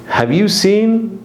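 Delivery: teacher modelling the phrase slowly; slow clear phrase demo. Shown as a demonstration. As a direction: stretch each word out slowly, pitched high. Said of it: "means I can't find something".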